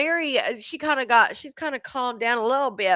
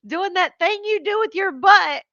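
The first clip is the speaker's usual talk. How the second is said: high, louder imitation of her; mock quote; 8 semitones higher, 5 dB louder than usual. Streamer retelling the princess's twerking remark, high-pitched mock quote. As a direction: push the voice up high and loud to mimic her.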